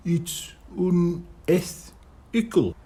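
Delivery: unethical said slowly and carefully, tried out syllable by syllable; careful try at a word. Acted. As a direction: test the word unethical like a new word. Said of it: "trying out the pronunciation".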